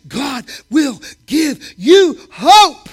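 slow and loud, leaning on hope; preaching at full force. Acted. Preacher promising hope to the church, full force. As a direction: drive the promise home.

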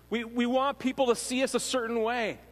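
normal talking voice

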